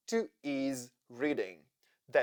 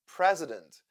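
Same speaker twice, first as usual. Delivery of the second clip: ordinary talk, nothing marked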